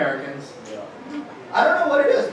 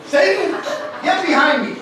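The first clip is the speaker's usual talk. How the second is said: high, loud retold command; imitating Jesus ordering someone back; acted rebuke; 8 semitones higher, 4 dB louder than usual. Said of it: "he turns around and he's like".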